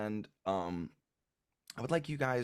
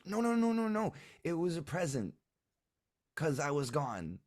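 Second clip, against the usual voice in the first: put-on high child voice; 10 semitones higher, 3 dB louder than usual. Child protests denial.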